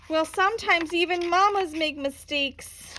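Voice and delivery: frightened cry. A narrator's reading. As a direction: blurt it in terror as the creature grabs. Scared climax of the refrain.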